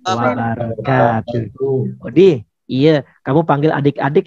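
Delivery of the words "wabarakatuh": slow, chant-like greeting reply; drawn-out salam. Pretend. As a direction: stretch the syllables out long and let it sink low by the end. Greeting answered in chanted drawl.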